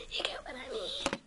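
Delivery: soft conspiratorial whisper. Pretend. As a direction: lean in and whisper it. Whispered aside to viewer.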